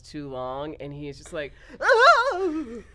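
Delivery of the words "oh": high, loud exaggerated "oh"; mock shocked cry. Retelling the jilted groom's altar reaction, loud high mock shock. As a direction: imitate a stunned, wounded wail. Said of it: "He let out this sound".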